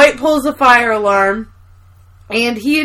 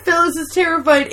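ordinary delivery

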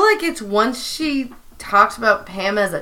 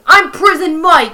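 bad New York accent, pitched up; Prison Mike; 5 semitones higher, 3 dB louder than usual. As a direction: put on a hammy New York tough-guy accent. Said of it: "really bad New York accent".

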